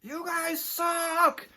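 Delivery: high, slow shouted jeer; retold heckle. Crowd jeering the opening band, slow high shout. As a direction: drag it out like a heckler booing the band. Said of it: "And I'm going".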